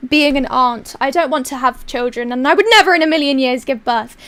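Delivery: ordinary delivery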